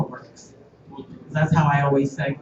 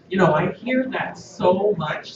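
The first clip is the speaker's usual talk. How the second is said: ordinary delivery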